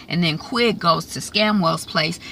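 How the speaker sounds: usual speaking voice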